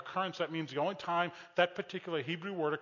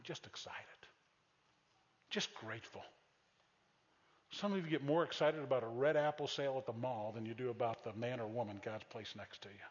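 ordinary delivery

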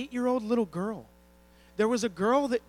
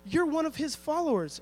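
higher, lightly voiced little girl; a hint of a young girl calling him out; little girl accusing; 6 semitones higher than usual. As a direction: point the finger like a bold kid. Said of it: "a little girl who says".

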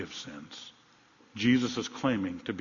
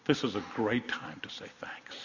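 whisper; 6 dB quieter than usual. Real, not acted. Closing appeal to the congregation.

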